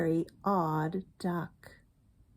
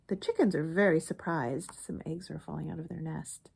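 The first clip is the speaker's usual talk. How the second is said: ordinary delivery, nothing marked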